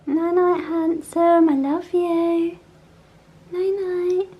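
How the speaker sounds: tender goodnight. Real, not acted. Mum saying goodnight to toddler.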